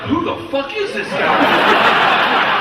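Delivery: high, loud, drawn-out astonishment; amazed yell. Retold or imitated. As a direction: holler it in awed disbelief. Amazed gangsters react to his act.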